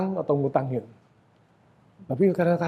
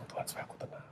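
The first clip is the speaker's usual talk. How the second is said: whispered prayer; 20 dB quieter, faster than usual. Acted. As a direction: whisper the plea. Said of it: "whispered prayer".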